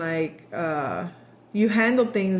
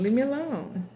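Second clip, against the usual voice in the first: heard message; 5 semitones higher than usual. Shown as a demonstration. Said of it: "I'm hearing".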